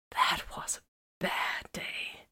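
slow rueful whisper. A narrator's reading. Vox to Titus, slow wistful whisper. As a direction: sigh it out, barely voiced.

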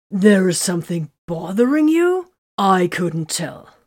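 loud sarcastic jab, slowing at the end; stinging sarcasm. A narrator's reading. Titus mocks Vox's hidden feelings with sarcasm. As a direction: needle him with mock surprise. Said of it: "his stinging sarcasm".

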